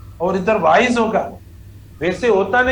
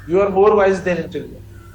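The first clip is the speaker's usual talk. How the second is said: slow dictation; 3 semitones lower, much slower than usual. Shown as a demonstration. Teacher dictating the corrected sentence.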